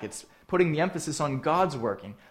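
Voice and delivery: ordinary delivery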